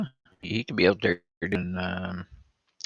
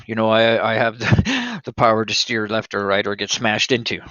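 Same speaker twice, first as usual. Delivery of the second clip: ordinary talk, nothing marked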